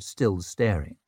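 usual speaking voice

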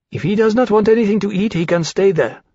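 quick, high, cold remark; coldly. A narrator's reading. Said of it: "rather coldly".

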